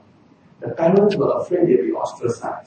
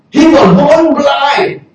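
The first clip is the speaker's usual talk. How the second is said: slow, high, loud and deliberate; emphatic insistence; 10 semitones higher, 6 dB louder, much slower than usual. Retold or imitated. Parents insisting to the Pharisees, slow loud emphasis. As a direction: lean on every word. Parents answering under pressure.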